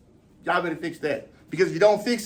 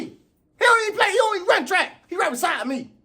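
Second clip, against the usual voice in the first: indignant disbelief; 8 semitones higher than usual. Acted. Sprinter outraged that a football player kept up. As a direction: squeal it in outrage. Sprinter ranting to his coach.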